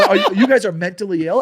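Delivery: high, loud insult breaking into a laugh; retold loud insult with a laugh. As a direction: yell it at them, half laughing. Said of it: "I go".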